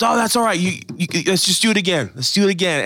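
God reassures someone who fell, kindly encouraging; gentle encouragement. Retold or imitated. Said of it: "he goes".

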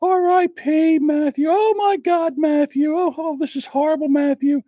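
high, wailing mock grief over Matthew; mock online mourner. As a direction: ham up the fake sobbing tribute. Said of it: "now whenever a celebrity passes".

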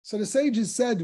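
ordinary talk

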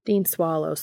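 ordinary delivery, nothing marked

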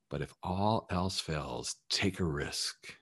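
soft slow repeat. Shown as a demonstration. Coach softly repeating his final advice, quiet and slow. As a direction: drop the voice and lay it down gently. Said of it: "coach repeats softly for emphasis".

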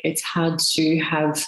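normal talking voice

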